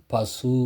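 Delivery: பசு with its last vowel held as a full oo; fully sounded u. Shown as a demonstration. Teacher showing the u sounds fully, பசு with held ending. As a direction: draw out the u of பசு completely. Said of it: "முழுமையா ஒலிக்குது".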